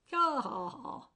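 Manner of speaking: loud, high ha ha ha; hearty laughter. A narrator's reading. Crump laughing along with the puzzled angel. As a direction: burst out laughing at the joke. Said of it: "joining in".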